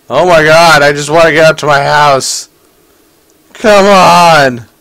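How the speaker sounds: loud, high exasperated shout; frustrated yell. Real, not acted. Frustrated climbing to house.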